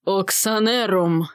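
slow, drawn-out spell word; low, rough incantation. A narrator's reading. Sorceress casting a spell, slow rough incantation. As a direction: intone it slowly in a rough voice. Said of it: "Голос у магии был низкий, грубый".

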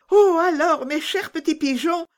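put-on high, loud child's plea; imploring little Gerda. A narrator's reading. Gerda imploring the dear little pigeons, high and urgent. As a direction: beg in an excited high little-girl voice. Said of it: "comme pour les implorer".

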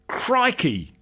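high startled cry of Crikey; sudden high-pitched exclamation; exclaimed. A narrator's reading. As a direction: burst out in shock. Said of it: "exclaimed Istvan".

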